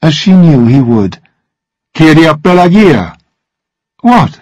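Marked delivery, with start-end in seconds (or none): 2.0-3.2 calling after her, slightly raised voice. A narrator's reading. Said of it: "He called out". 4.0-4.4 high-pitched, defensive question; curt, indignant query; sharp demand. A narrator's reading. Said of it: "she demanded".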